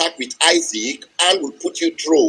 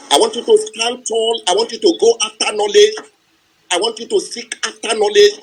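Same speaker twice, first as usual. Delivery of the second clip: rousing speech; 3 semitones higher than usual. Acted. Motivational closing speech.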